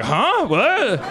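high, loud mock bewilderment; baffled Uber driver. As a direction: act the driver not understanding a word. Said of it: "the driver would be like".

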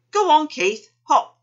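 loud, high urging; high, punchy command to jump. A narrator's reading. Kangaroo urging Keith to hop.

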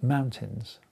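normal talking voice

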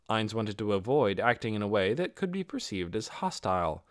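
plain reading voice

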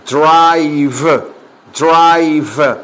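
Drive, drive, each stretched out with a strong final v; teacher's slow model of "drive", ending fully sounded; well-marked ending. Shown as a demonstration. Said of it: "bien pronunciada". ("drive" alargado, con final bien pronunciado)

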